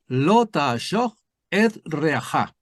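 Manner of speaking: very slow Hebrew lo ta'ashoch et reaja; Hebrew reading. Shown as a demonstration. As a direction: chant lo ta'ashoch et reaja carefully. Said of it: "empieza diciendo". (lectura pausada en hebreo)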